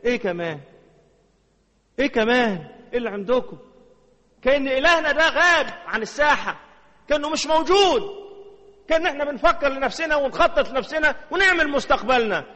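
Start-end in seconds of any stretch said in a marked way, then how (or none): 2.0-3.6 إيه كمان pushed out higher and louder; repeated challenge, louder; louder insisting. Shown as a demonstration. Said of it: "repeated, louder". 4.4-12.4 high, loud rebuke; raised, fervent reproach; fervent preaching. Real, not acted.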